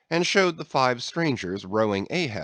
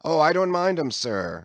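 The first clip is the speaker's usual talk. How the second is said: higher, louder sailor's voice; young sailor; 6 semitones higher, 4 dB louder than usual. A narrator's reading. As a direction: brush it off to the mate. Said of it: "said Archie".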